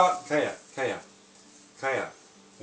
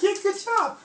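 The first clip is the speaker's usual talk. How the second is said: excited praise; 6 dB louder than usual. Real, not acted. Praising toddler.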